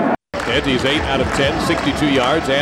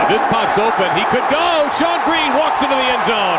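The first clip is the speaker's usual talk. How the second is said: rising, excited play-by-play call; touchdown call; 8 semitones higher than usual. Real, not acted. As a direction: lift the pitch as the runner breaks free.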